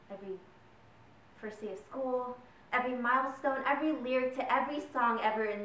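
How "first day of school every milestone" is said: ordinary delivery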